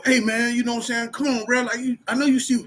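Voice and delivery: mock tough-guy callout; old hothead self. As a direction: get in his face like you used to. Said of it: "that's how I used to be".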